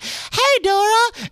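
very high, loud cartoon squeal; in-character Boots. Costumed performer bouncing around calling to Dora, high squeal. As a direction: squeal a bouncy stage greeting. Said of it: "bouncing around and being like".

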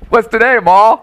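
high hammed-up hillbilly twang calling to Ma; hillbilly accent. Voicing Kentucky hillbilly.

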